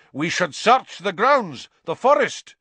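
loud, high angry demand; angry snarl. Acted. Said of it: "the executioner was snarling".

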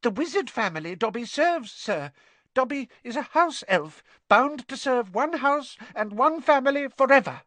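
high, squeaky servant elf; earnest house elf. Acted. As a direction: explain solemnly in a high little voice.

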